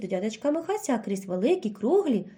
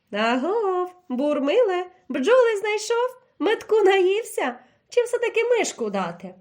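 higher, teasing owl taunt; mocking; 7 semitones higher, 3 dB louder than usual. A narrator's reading. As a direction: jeer at the bear from the hollow. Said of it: "глузливо усміхалася". (сова глузливо дражнить ведмедя, вище)

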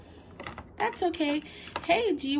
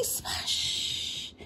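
whispered splash; 6 dB quieter than usual. Acted. Landing in the pool.

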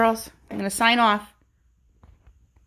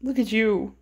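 ordinary delivery

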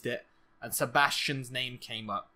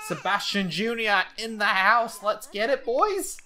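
loud, higher rallying shout; hype cheer; 5 semitones higher, 4 dB louder than usual. Pretend. Gamer hyping Sebastian Junior to the boys, excited cheer. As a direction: whoop it up, rising on the last word.

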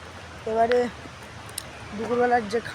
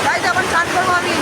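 excited; 8 semitones higher, 7 dB louder than usual. Real, not acted. About to bathe in cold water.

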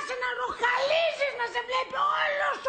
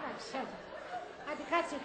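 much softer, low soothing voice; hushed placating; 9 semitones lower, 13 dB quieter, much faster than usual. Acted. Partner calming a raging one at night, hushed. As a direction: settle them down quietly.

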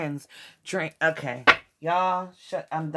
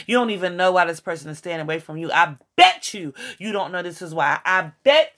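usual speaking voice

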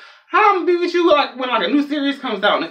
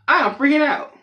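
mock freak-out; 9 semitones higher than usual. Acting panic at scary movie.

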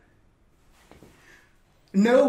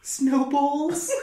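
normal talking voice

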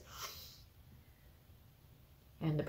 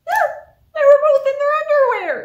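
high, loud, delighted reveal; excited punchline; 12 dB louder than usual. Acted. Storyteller telling children the funny ending, high and loud. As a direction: burst out with the silly ending.

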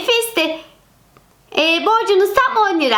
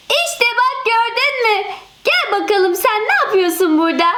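usual speaking voice

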